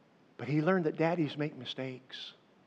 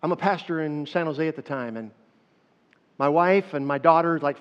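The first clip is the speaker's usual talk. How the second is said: normal talking voice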